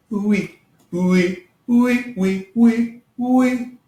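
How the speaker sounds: "oui" repeated high, lips rounded forward; high, separate "oui"s gliding from oo into ee; pushed-out lips. Shown as a demonstration. Teacher drilling French yes, "oui" high with pushed-out lips. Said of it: "push out your lips a little more".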